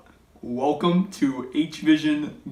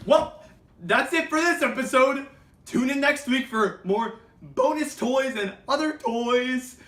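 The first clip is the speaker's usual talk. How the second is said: cheery outro; 9 semitones higher than usual. Acted. Cheery show sign-off.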